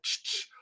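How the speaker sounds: soft imitated "ch-ch" clicks; hushed, toneless kangaroo chitter; kangaroo noise. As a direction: make a quiet kangaroo sound. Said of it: "The kangaroo went".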